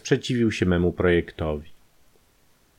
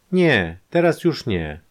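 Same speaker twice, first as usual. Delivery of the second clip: plain reading voice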